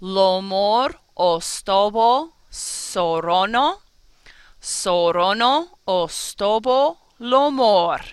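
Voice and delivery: "ostobo" and "sorono" with rounded o vowels, slow; all vowels as o. Shown as a demonstration. Teacher models the o rhyme, slow, lips rounding. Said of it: "watch my mouth".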